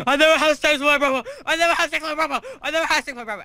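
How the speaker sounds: high, loud, frantic mock scream; hammed-up hysterical screaming, rapid-fire. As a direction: shriek a repeated denial in imitation.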